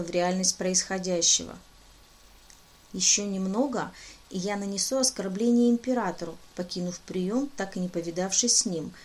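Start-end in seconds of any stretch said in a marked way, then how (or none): none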